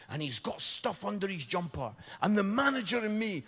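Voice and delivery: ordinary talk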